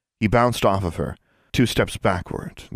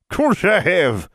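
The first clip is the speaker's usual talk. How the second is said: put-on old witch voice; 11 semitones higher, 5 dB louder, much slower than usual. A narrator's reading. Abigail answering defensively.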